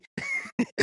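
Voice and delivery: a sudden high burst of laughter at his own rant; laughing at himself. Real, not acted. Host laughing at himself after ranting about the vaccine, high burst of laughter. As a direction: break into a high laugh, caught out by yourself.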